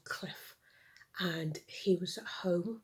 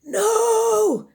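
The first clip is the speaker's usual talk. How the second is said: much higher, louder panicked cry; shouted No; 16 dB louder than usual. Acted. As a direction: yell it again in horror. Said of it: "shouted Clef".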